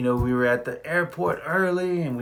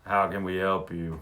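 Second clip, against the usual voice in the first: lower, flat front-desk voice; a bored receptionist greeting guests; lightly voiced desk clerk; hotel clerk; 5 semitones lower than usual. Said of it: "they're like".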